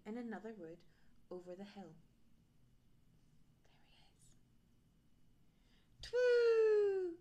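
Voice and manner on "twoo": long, loud high twoo; falling drawn-out hoot; owl hoot. A narrator's reading. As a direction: toot it out like a lonely owl. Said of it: "he tooted".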